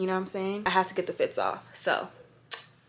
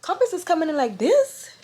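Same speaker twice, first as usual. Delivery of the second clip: higher and louder, impressed; surprised; 9 semitones higher, 4 dB louder than usual. Retold or imitated. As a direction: react like a pleasant shock. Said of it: "I'm like".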